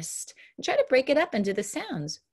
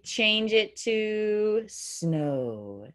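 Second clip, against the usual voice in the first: drawn-out "Change it to snow"; very slow instruction; much slower than usual. Shown as a demonstration. Teacher slowly giving the next change, very slow. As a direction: stretch every word out. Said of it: "Change dirt to spook".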